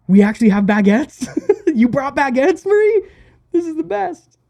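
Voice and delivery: high, giggly, delighted disbelief; mock-excited. Band teasing the French tour manager, mock-excited. Band's gleeful mock reaction, acted out.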